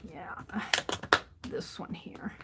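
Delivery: whispered to herself. Real, not acted. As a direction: murmur it under the breath. Quiet muttering while drawing.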